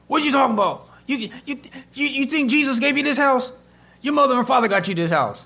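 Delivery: high, loud indignant outburst; scolding, outraged dad voice; mad dad. Retold or imitated. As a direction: imitate an angry father sputtering. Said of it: "and he was mad".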